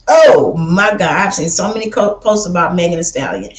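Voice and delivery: ordinary talk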